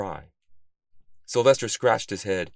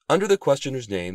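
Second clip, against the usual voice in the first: plain reading voice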